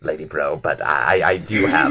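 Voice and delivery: low, quick, fumbling excuse; Ort's voice. Acted. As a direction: mumble the excuse fast and low. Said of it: "he goes".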